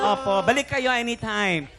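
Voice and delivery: usual speaking voice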